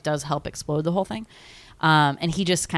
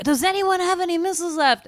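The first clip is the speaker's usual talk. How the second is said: high mock president; 12 semitones higher than usual. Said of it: "so president's like".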